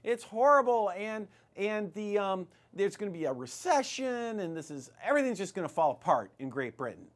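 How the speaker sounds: high, loud alarmed wailing, hammed up; a parody of a frantic expert crying disaster; mock panic. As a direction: play the doom-saying economist in a mock panic. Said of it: "all the economists were saying".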